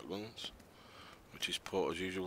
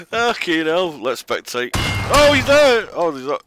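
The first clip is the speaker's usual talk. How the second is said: excited shout; 9 semitones higher, 16 dB louder, slower than usual. Real, not acted. Chaotic fight, shouting.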